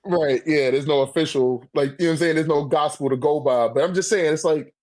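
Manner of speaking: ordinary delivery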